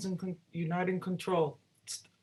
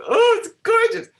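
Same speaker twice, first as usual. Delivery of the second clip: much higher and louder, a retold overwhelmed cry of delight; overwhelmed gushing; 11 dB louder than usual. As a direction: relive hearing the passes, gushing high and loud. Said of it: "I just sat there crying".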